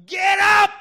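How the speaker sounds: full-throated shout at a lame man; yelling. Retold or imitated. Preacher acting out Paul yelling at the lame man, shouting. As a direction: bellow the command to rise. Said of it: "He was yelling at this guy".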